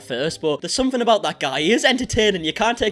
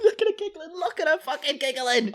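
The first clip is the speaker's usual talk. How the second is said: disgusted; 9 semitones higher than usual. Real, not acted. Disgusted at giggling on video.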